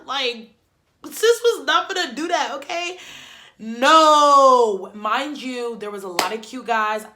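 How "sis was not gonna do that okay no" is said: emphatic no. Real, not acted. Refusing soccer with half tooth.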